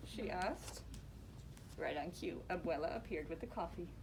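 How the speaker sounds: ordinary delivery, nothing marked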